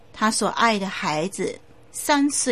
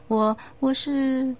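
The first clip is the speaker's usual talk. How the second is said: mumbled hesitant answer; 5 semitones higher, slower than usual. Acted. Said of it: "含含糊糊的说".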